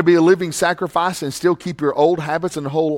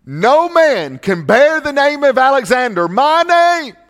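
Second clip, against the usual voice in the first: higher and louder, climbing on my name; thundering repeat; 11 semitones higher, 6 dB louder, slower than usual. Real, not acted. Preacher repeating Alexander's sentence to the church, loud and climbing. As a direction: hammer the line home.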